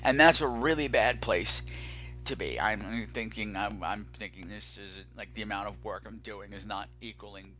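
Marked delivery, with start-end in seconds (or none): 2.6-7.5 much softer, trailing exhaustion; weary murmur. Real, not acted. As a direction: mumble it out, worn down. Exhausted host doubting his work.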